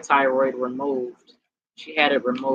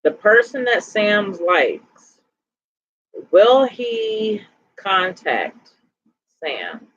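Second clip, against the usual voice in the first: drawn out and higher, asking the pendulum; slow, lifted, asking the spirits; slow ritual question; 5 semitones higher, much slower than usual. Real, not acted. Reader asks pendulum about Sam's crush, slow and lifted.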